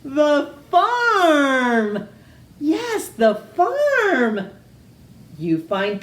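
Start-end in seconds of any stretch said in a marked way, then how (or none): 0.0-4.5 high, loud triumphant cry; triumphant shout. Acted. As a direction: crow with delight at the answer. Witch's final eureka.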